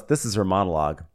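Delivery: ordinary talk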